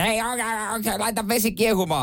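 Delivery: high nagging partner calling from the utility room; a nagging spouse's high call. Retold or imitated. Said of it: "nalkuttava puoliso".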